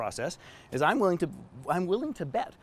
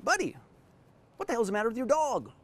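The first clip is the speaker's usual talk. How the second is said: higher, lightly voiced old man asking; a puzzled old neighbour, pitched up; old Southern visitor; 7 semitones higher than usual. Visitor asks his friend about the dog, puzzled high voice. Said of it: "And the visitor says".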